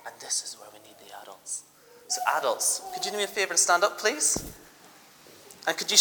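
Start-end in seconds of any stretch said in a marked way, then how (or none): 0.1-1.6 quick whisper to the crowd; whispered aside. Real, not acted. Teacher whispers to the children before calling up the adults, quick whisper. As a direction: drop to a whisper, a secret aside. Aside to the audience mid-story.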